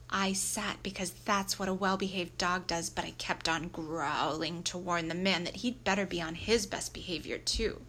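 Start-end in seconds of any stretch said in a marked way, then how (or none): none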